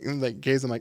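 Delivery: normal talking voice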